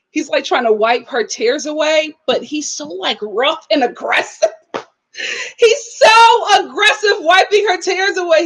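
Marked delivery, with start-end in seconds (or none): 5.6-8.4 higher and louder, laughing through the words. Real, not acted. Vlogger laughs describing the brother's rough tear wiping. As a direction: crack up as you describe it.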